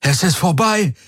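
high, relieved cry; called out. A narrator's reading. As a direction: call out the news. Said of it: "rief er". (erleichtert gerufen)

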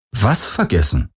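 lightly mock-puzzled question; a touch of put-on bewilderment; feigned confusion. A narrator's reading. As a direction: play innocent, as if lost. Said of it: "fragte Garland gespielt verwirrt".

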